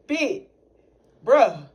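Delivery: ordinary talk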